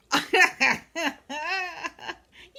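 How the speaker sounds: high, loud belly laugh. Real, not acted. Grandma breaking into a belly laugh on her livestream. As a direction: let out a big belly laugh. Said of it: "I can't hardly belly laugh".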